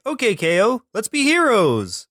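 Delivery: high, punchy show-title announcement; emphatic title call. Shown as a demonstration. Asking co-host how to say the show's title, emphatic. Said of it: "Are you supposed to say".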